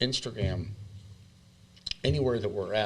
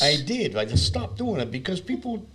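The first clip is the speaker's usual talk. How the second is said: put-on voice; 7 semitones higher than usual. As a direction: keep up the high odd voice, complaining. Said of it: "if I talk like this".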